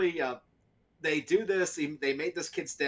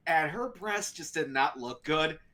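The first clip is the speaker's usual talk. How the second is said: normal talking voice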